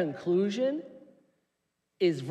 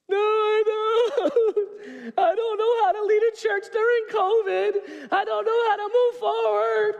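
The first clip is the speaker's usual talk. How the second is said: high, loud, laughing half-cry; hammed-up tearful protest to God; mock whining; 13 semitones higher, 5 dB louder than usual. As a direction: whine like a stuck kid. Himself whining back to God.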